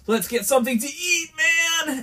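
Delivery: high-pitched, overexcited dude; hammed-up eager coworker call; imitated coworker. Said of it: "he was like".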